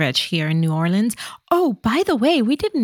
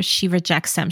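ordinary talk, nothing marked

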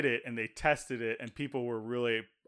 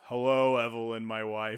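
imitating Eeyore; 5 semitones lower than usual. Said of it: "his name's Eeyore".

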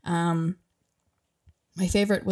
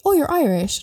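higher, louder imitation of an eager tourist; mock excited tourist; 8 semitones higher, 5 dB louder than usual. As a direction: chirp it like a keen traveller meeting a local. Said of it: "people across the world that say".

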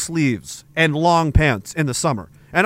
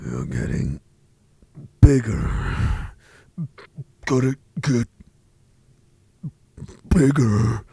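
very low, slow, far-off growl; deep monster voice; 9 semitones lower, 4 dB quieter, slower than usual. Pretend. Mock monster voice urging the viewer to grow. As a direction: intone it like a looming beast. Eerie chant about getting bigger.

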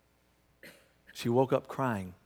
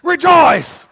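Rejoice! belted out much higher and louder; shouted rejoice; 16 dB louder than usual. Shown as a demonstration. Pastor repeating Paul's call to the church, loud shout. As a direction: proclaim Rejoice! at full volume. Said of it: "I'm gonna say it again".

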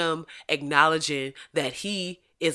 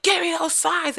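mock angry yell; 13 semitones higher than usual. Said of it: "and he's like".

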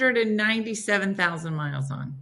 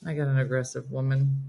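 lightly voiced trucker calling on the CB radio, a bit lower and softer; CB trucker radio talk; 7 semitones lower, 6 dB quieter than usual. Friend on the earpiece doing a lightly voiced CB radio call. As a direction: breaker one nine, a mock radio call-in. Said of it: "She's like".

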